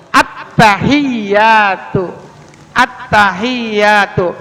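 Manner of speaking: very slow "Attahiyatu", held t and y; clear tasydid. Shown as a demonstration. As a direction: stretch "Attahiyatu" syllable by syllable, pressing the doubled letters. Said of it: "Ya-nya ada tasdid juga". ("Attahiyatu" pelan dengan tasydid jelas)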